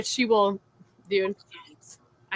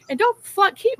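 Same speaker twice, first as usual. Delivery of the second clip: higher, louder burst of anger; angry flare-up; 9 semitones higher, 3 dB louder than usual. Real, not acted. Woman warning a critic off her kids, angry flare-up. Heated warning in online argument.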